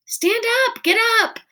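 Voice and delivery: high, eager urging; kids urging. A narrator's reading. Kids urging Auggie to stand, high excited calls. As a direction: cheer him up out of his seat. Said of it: "said the kids all around me".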